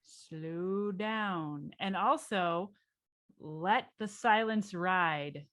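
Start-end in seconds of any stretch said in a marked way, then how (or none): 0.0-1.7 long stretched words, softer; slowed cadence. Retold or imitated. Consultant learning Midwest clients' cadence, slowed right down. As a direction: ease right down, drawing out each word. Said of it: "learn their cadence".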